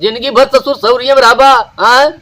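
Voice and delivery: loud, heated telling-off; angry scolding. Acted. Parent berating a grown child by the fire, angry scolding. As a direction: scold with rising volume and force. Acted family quarrel, scolding.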